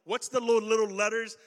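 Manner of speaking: loud, pressing question; loud challenge to a salesman about the fine print; mock suspicious demand. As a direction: push them on the small print. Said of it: "And I'm like".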